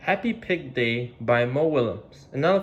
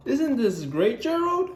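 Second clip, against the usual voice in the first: happy Piggy; 12 semitones higher than usual. Acted. Piggy telling Gerald she is happy, high and bright. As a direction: bubble over with joy. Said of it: "Piggy said".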